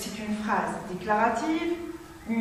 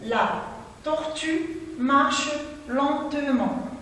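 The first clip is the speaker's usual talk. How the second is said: slow, careful repeat of tortue marche lentement; 2 semitones higher, slower than usual. Shown as a demonstration. Teacher repeating the sentence for pupils, slow. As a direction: say it again slowly, word by word. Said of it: "Je répète".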